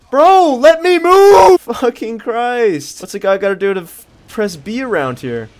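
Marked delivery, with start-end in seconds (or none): 0.1-3.0 very high, loud, slow stretched shout; real rage yell; frustrated yell. Real, not acted. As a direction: scream at the game that won't let you move. Stuck in web, yelling.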